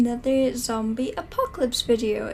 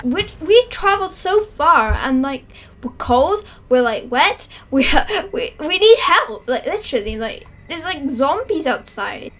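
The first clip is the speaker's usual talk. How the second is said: loud desperate pleading; 3 semitones higher, 9 dB louder than usual. Pretend. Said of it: "they're like".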